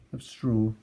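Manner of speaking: usual speaking voice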